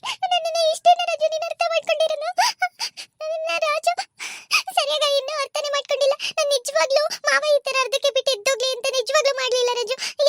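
put-on high, hurt, teary young wife pleading; wounded wife near tears, high and pleading; tearful protest. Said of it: "ಯಾಕೆ ಇವಳು ಇಷ್ಟೊಂದು ಅಳ್ತಿದಾಳಲ್ಲ?".

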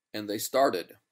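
usual speaking voice

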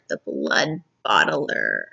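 very low, slow and drawn out; deep, menacing story-villain voice; ominous title. A narrator's reading. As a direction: announce it like a looming monster. Spooky repeat of chapter title.

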